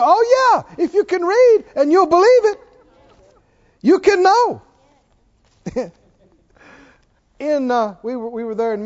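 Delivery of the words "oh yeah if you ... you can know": high, loud exclamation; emphatic. Real, not acted. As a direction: burst out with total assurance. Answers acted doubt emphatically.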